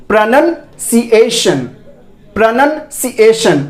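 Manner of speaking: slow "pruh-nun, si-ay-shun" for "प्रोनाउंसिएशन"; careful syllable-by-syllable pruh-nun-si-ation; correct pronunciation. Shown as a demonstration. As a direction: split "प्रोनाउंसिएशन" in two, short "pruh", rising then falling. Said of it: "इसका जो सही उच्चारण है".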